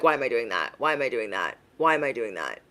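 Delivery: hushed, baffled self-check; quiet self-questioning. Retold or imitated. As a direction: ask yourself quietly in dismay. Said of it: "And I'm like".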